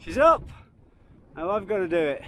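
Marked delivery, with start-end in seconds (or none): 0.0-0.4 high, loud delighted cheer; excited cheer. Real, not acted. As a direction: cheer her on at the top. Companion reaches the trig top.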